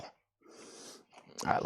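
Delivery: breathy whispered aside. Real, not acted. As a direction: drop to a whisper. Sudden drop to unvoiced aside.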